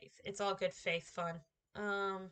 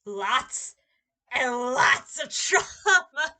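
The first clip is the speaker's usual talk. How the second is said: laughing out the word trauma, slow; laughing shout; 4 semitones higher, 11 dB louder, much slower than usual. Real, not acted. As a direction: breaks into a laugh mid-shout. Laughing rant about Piccolo's training.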